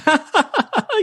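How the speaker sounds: high, hammed-up nervous giggle; a silly falsetto laugh brushing it off; mock giggle. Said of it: "you're like".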